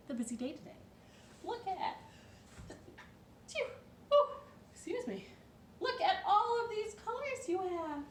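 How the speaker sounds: a cheerful owner talking to her pet; owner speaking to Dog. Acted. Owner scolding Dog kindly about his colours, lightly voiced. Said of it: "looked at him and said".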